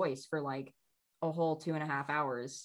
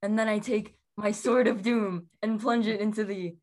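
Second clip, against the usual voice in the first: louder, higher, slow melodramatic narration; mom's D&D impression; 4 semitones higher, 5 dB louder, slower than usual. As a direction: ham it up like an epic storyteller. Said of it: "My mother's impression of us playing D and D".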